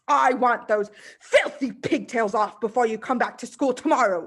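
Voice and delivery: loud headmistress bark. Acted. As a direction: bark the order at the cowering girl. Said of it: "she barked".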